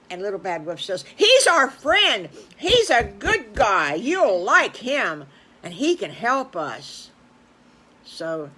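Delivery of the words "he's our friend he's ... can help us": loud, slow, emphatic enthusiasm; big-hearted booming endorsement; eager vouching. Acted. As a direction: proclaim it loudly, stretching each word. Wolf eagerly vouches for the farmer.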